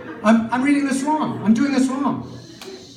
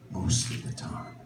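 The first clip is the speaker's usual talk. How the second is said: hushed whisper; 9 semitones lower, 12 dB quieter than usual. Acted. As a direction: whisper the lyric, almost no voice. Lyric quoted, whispered.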